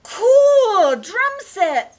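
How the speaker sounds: high, very loud exclamation; thrilled. Acted. Denton seeing the drum set, thrilled. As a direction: shout it out in delight.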